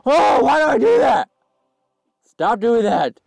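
high, loud self-scolding; frustrated shouting. Real, not acted. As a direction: shout in dismay, easing slightly at the end. Scolds self for game mistake.

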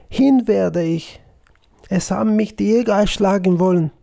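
ordinary delivery, nothing marked

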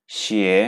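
শিয়ে rising, Chinese xie; Mandarin second tone. Shown as a demonstration. Teacher demonstrates the rising tone on shoes word. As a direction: slide শিয়ে upward. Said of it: "শিয়ে second tone".

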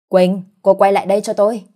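loud, commanding call; calling out loudly. A narrator's reading. Husband shouting after his departing wife, calling loudly. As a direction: call her back with force. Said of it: "ở phía sau gọi lớn".

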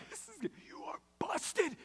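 high, whispered sibling glee; giddy hushed squeal at another's trouble; gleeful gloating. Retold or imitated.

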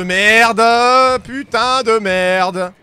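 slow, loud stretched-out curse; drawn-out swearing. Real, not acted. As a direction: drag out every word in dismay. Panicked swearing, monster attacking. (juron traînant et fort)

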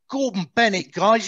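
put-on Cockney accent. Prisoner greeting his captors, in a broad Cockney accent. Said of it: "in the biggest Cockney accent".

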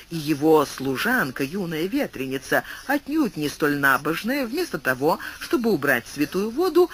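ordinary delivery

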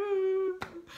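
high, strained sobbing groan; sobbing ugh; crying sob. Retold or imitated. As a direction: let out a retold sob.

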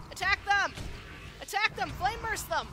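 high, loud attack calls; battle shouting. Real, not acted. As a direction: yell orders in the heat of the fight.

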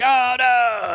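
loud, very high mock wail; hammed-up falsetto alarm; falling cry. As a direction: cry out while toppling backwards. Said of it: "He pushes, he's like".

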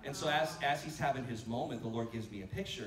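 ordinary talk, nothing marked

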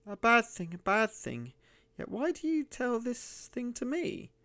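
put-on suspicious old miser in a high, cracked voice; high, croaking old miser. A narrator's reading. Narrator voicing the miser answering the stranger, high and wary. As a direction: pitch it way up like a wary old hermit.

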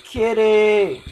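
loud, high call of grief. Real, not acted. Gamer mourning his in-game kitty, calling out loud. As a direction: cry out the pet's name.

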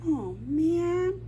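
ordinary delivery

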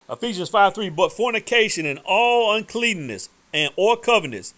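loud, slow, deliberate scripture reading; preaching at full force. Acted. Preacher reading Ephesians against jesting, loud slow preaching. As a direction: weigh every word like a sentence handed down.

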